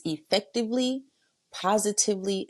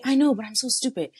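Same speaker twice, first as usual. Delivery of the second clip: self-blaming answer; 7 semitones higher, 4 dB louder, faster than usual. Acted. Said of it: "In my head, I'm doing the answering".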